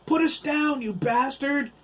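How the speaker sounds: high, loud wailing yell. Acted. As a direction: wail it up at the giant in panic. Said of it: "Dabney wailed".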